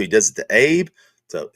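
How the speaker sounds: usual speaking voice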